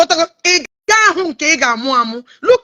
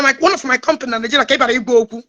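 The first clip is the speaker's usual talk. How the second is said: usual speaking voice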